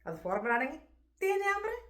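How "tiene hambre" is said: "tiene hambre" pitched high as a question; question intonation. Shown as a demonstration. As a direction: ask "tiene hambre" with a raised, questioning tune. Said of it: "ഒരു question മാർക്കിൻ്റെ ഒരു രൂപത്തിൽ".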